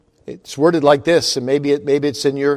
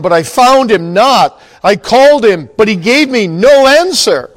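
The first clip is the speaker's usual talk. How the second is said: louder, declaiming psalm reading; fervent scripture reading; 2 semitones higher, 5 dB louder than usual. Acted. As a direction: proclaim the psalm to the congregation. Reads Song of Solomon aloud.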